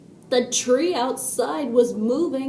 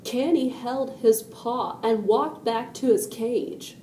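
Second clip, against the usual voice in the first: ordinary delivery, nothing marked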